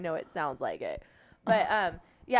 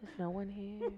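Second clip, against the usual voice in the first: mock whisper; 7 dB quieter than usual. Continues the whispered joke.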